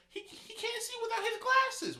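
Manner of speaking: very high, loud mock wail; distraught girl. Retelling the girl at a boy's death, mock distraught wail. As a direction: imitate a grieving girl, voice way up. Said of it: "the girl's like".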